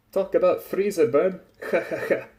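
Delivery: loud, high quip into a laugh; hammed-up hearty ha-ha-ha; hearty chuckle. A narrator's reading. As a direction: crack a joke and chuckle at it. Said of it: "After his hearty chuckle".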